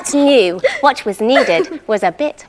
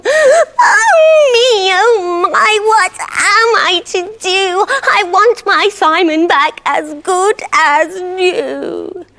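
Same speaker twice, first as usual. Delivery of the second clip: wailing; 7 semitones higher than usual. Acted. Wife wails for her lost husband, hammed-up. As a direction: wail over lost Simon. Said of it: "she began to cry and wail".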